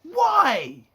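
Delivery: loud, high drawn-out yell; long incredulous wail of protest; exasperated shout. Retold or imitated. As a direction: cry out in baffled outrage. Acts out exasperated reaction to scene.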